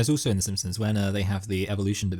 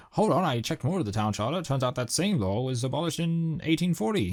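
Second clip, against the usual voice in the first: Simpsons town official; 6 semitones higher than usual. Retold or imitated. Co-host re-enacts the Prohibition episode for his friend, high cartoon voice. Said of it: "he's like".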